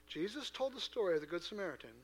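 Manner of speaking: normal talking voice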